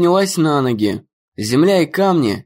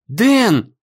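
calling out; 7 semitones higher than usual. Acted. Amy cries out as Dan falls.

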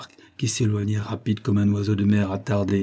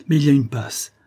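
a touch of fear, higher voice; growing terror; 5 semitones higher, 4 dB louder than usual. A narrator's reading. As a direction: plead anxiously that there is a way. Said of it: "dont la terreur augmentait au fur et à mesure". (peur légère, voix plus haute)

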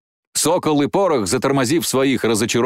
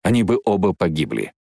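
ordinary delivery, nothing marked

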